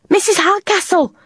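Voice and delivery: high calling cry across distance. Acted. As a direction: call out her name. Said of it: "she called".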